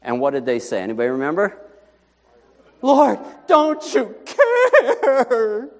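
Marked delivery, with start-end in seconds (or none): 2.8-5.7 high, slow, crying plea; panicked disciples. Acted. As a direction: wail it out terrified of drowning.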